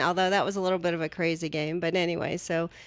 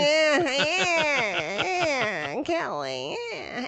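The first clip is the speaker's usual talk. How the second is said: drawn-out whining yeahs, starting high; Fran Drescher; 7 semitones higher, much slower than usual. Acted. Radio co-host answers teasing about her voice, mock celebrity whine. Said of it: "That Fran Drescher voice?".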